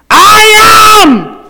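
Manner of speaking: a huge divine shout; thundering voice of God. Acted. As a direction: roar it like God from the mountain. God's voice thundering at Sinai.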